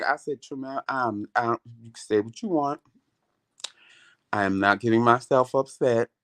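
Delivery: normal talking voice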